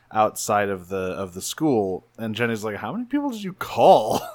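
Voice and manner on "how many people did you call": high-pitched incredulous question; mock astonished voice; startled disbelief. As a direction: act taken aback by the turnout. Retold startled question at crowd.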